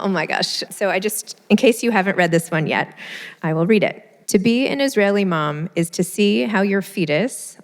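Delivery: normal talking voice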